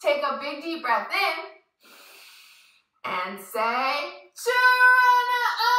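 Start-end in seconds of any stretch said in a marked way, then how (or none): 4.4-5.7 muffled loud high shout through cupped hands; far-away yell. Shown as a demonstration. Kids yelling Geronimo through hands over mouth, far-away shout. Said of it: "put your hands over your mouth so you sound really far away".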